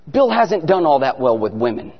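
usual speaking voice

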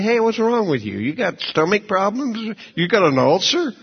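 surprised barfly. Retold or imitated. Friend in the bar baffled by his tomato juice, high and incredulous. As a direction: play the puzzled friend at the bar.

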